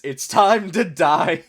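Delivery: dramatic mock threat. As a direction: play up the threat, slowly and loudly. Reads meme threat dramatically.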